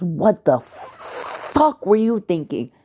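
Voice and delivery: high, slow, full-force What the fuck; furious outburst. A narrator's reading. Jiraiya explodes at Hiruzen for letting Naruto go. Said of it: "He says it with that much energy".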